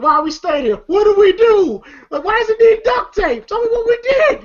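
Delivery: very high, pleading mock panic; imitated panic. Roach asking about the duct tape, mock panic. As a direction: plead frantically, hammed up for comedy.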